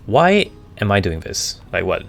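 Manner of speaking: normal talking voice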